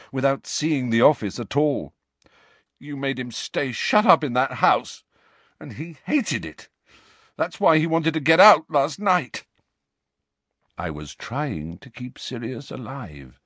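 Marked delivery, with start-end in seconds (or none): 2.8-9.5 high, upset reproach; bitter accusation. Acted. Harry accuses Dumbledore bitterly. 10.8-13.4 soft, restrained defence; quiet reply. A narrator's reading. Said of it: "said Dumbledore quietly".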